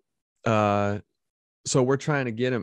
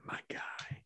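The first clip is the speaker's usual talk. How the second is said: whisper; 19 dB quieter than usual. Real, not acted. Whispered approval.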